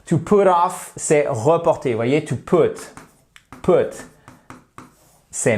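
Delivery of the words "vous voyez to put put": ordinary talk, nothing marked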